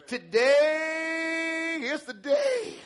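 high, long-held "day"; elated cry. Acted. Preacher voices the woman on her awaited day, elated. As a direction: stretch it out slowly and jubilantly.

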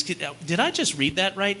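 normal talking voice

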